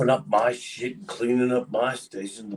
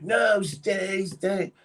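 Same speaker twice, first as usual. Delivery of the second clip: higher, insistent no, no, no; pleading manager; 11 semitones higher, much slower than usual. Retold or imitated. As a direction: beg him not to leave. Manager begging him to stay.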